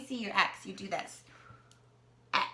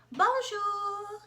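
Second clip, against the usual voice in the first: playful French greeting; 9 semitones higher, 4 dB louder than usual. Acted.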